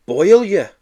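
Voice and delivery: loud high gloating jeer; gloating taunt. Acted. Child to Joe, loud gloating jeer. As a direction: taunt him with relish.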